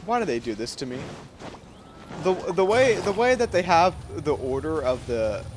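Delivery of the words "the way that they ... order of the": ordinary talk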